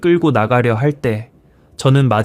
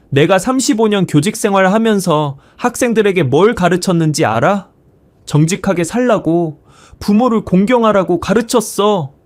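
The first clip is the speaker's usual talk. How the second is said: higher-pitched, indignant lecture; glaring rebuke; 7 semitones higher than usual. A narrator's reading. Former teacher glaring at his son, indignant higher-pitched rebuke. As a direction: scold with the pitch pushed up. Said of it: "저는 아들을 노려보며 말했어요".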